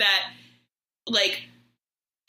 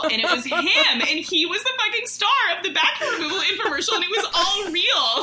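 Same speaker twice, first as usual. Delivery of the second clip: high, laughing through the words; can barely get it out for laughing; laughing reveal; 8 semitones higher than usual. Real, not acted. Revealing to friends the infomercial star was real, laughing high.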